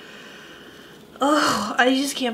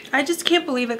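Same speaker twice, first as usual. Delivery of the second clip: ordinary talk, nothing marked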